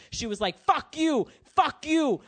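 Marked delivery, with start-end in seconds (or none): 0.6-1.3 high, angry mock yell; imitated yelling mom. Immigrant mom yelling at the driver who hit her, high mock fury. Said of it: "starts yelling at this dude". 1.4-2.2 high, angry mock yell; imitated yelling mom. Immigrant mom yelling at the driver who hit her, high mock fury. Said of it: "starts yelling at this dude".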